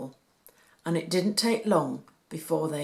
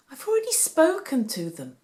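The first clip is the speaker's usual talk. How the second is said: sighing mother; 8 semitones higher, 4 dB louder than usual. A narrator's reading. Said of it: "said Mary with a sigh".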